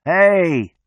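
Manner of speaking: loud, high, drawn-out hey; yelling. A narrator's reading. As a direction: keep yelling for attention. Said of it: "me still yelling".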